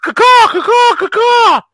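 high, loud cawing crow. Retold or imitated. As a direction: caw it out like a crow.

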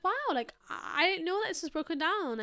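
high, amazed wow; retold surprise. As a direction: replay the shock of seeing it gone. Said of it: "but I was like".

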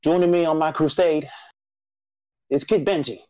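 rally the nation like a solemn show herald; grand announcer intro. Acted.

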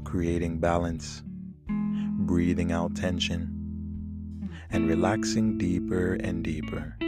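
calm and soothing over the music. Acted. Leads a guided meditation.

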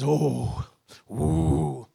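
mock shocked listener. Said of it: "someone that says".

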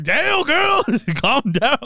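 high-pitched hyped-up exclamation; excited exclamation. Retold or imitated. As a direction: squeal it out, amazed. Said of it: "I was like".

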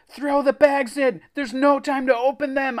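high, loud panicked shout. A narrator's reading. Narrator orders friends as blob nears chest, shouting. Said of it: "I shouted".

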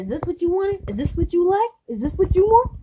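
high, rising mocking questions; acted aggressive taunting. Acts out his taunts while squeezing.

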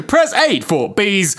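very slow automated prompt; recorded hotline menu; phone menu voice. Pretend.

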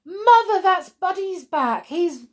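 high, loud thrilled cry; excited cry. Acted. Boy Robin calling to his mother about the dog, excited shouting. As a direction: shout the news with joy. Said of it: "he cried".

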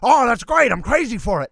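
loud, high-pitched burst of approval; delighted director. Retold or imitated. Director approving the actor's accent attempt, loud excited approval. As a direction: gush loudly as the thrilled director.

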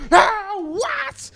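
high, loud overdone gasp of shock; exaggerated shocked reaction. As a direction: ham up a stunned reaction. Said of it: "reação muito exagerada". (reação exagerada de espanto)

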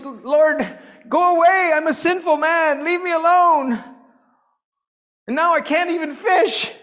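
high, loud cry of despair; desperate shouted plea. Acted. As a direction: cry it out as Peter, pushing Jesus away. Acting out Peter's plea to Jesus.

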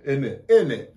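clipped British "In it."; mock British "In it."; British accent. Shown as a demonstration. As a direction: say "In it." like a Londoner. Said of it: "They say in it".

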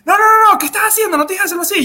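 high, agitated imitation of scolding; annoyed instructor. Ski instructor correcting a confused pupil, high-pitched annoyance.

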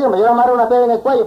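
a hint of the sly rabbit talking fast; rabbit coaxing.

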